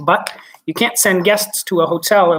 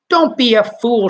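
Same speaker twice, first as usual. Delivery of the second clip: sharp, higher, louder snap; snapped; 5 semitones higher, 5 dB louder than usual. Acted. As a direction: cut him off impatiently. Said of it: "Shuckworth snapped ground control".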